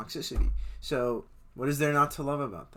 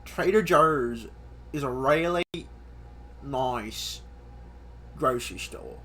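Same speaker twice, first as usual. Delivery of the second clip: put-on Australian accent. Vlogger plugging Trader Joe's, in a mock Australian accent. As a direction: say it like an Aussie would. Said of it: "I did an Australian accent".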